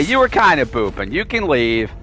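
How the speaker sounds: ordinary delivery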